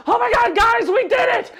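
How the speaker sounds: high, loud squeal of triumph; triumphant shout. Acted. Sav looks back at his teammates, shouting high. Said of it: "he goes".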